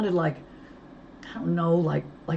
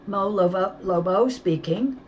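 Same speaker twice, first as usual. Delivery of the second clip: higher and louder, tight with nerves; brittle nervous voice; 5 semitones higher, 5 dB louder than usual. A narrator's reading. Mo answering the kidnapper's call, voice like glass. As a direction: force it out, trying to sound brave. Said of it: "my voice like glass".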